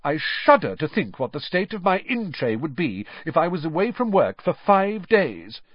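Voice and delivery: officious and superior; sanctimonious. A narrator's reading. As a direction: fuss over the in-tray. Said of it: "said Percy sanctimoniously".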